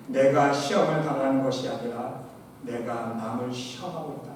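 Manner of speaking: ordinary talk, nothing marked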